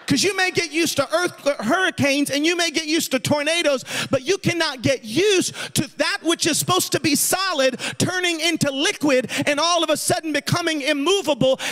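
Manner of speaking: rousing preacher's cadence; preaching. Real, not acted. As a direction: preach it with conviction, drawing out the key phrases. Preaches a point at full force.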